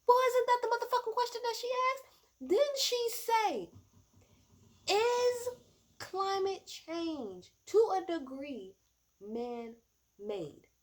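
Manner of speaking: high, loud, exasperated shout; indignant yelling at the debate; outraged yell. Real, not acted. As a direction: scream it in disbelief. Outraged rant about the question.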